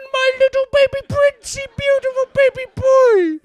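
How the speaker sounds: very high, loud cooing parent; doting baby talk. Acted. Parent fussing over the little baby prince, hammed-up baby talk. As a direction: gush over a baby in an exaggerated squeal.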